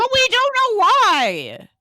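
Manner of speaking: high, loud, drawn-out wail of protest; mock outcry. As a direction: cry out in hammed-up frustration at not knowing. Co-host's mock outcry at missing explanation.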